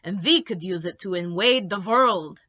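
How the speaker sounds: a hint of sly menace; sly vampire. A narrator's reading. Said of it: "with a sly, sharp smile".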